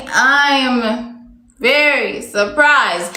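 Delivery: drawn out slowly, the dull version; teacher showing students the boring phrase, slow; slow flat example. Shown as a demonstration. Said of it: "Instead of saying".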